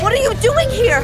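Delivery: high, loud startled demand; shocked shout. Acted. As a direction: yell in alarmed surprise. Daughter appears unexpectedly.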